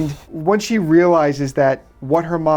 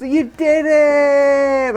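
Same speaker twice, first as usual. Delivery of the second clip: put-on high, slow valley girl squeal; 11 semitones higher, 5 dB louder, much slower than usual. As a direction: drag it out like a fake California girl. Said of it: "Like a fake California girl".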